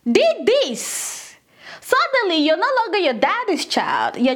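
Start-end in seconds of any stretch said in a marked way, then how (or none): 0.0-1.3 disowning dad. Retold or imitated. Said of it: "your dad says to your mom".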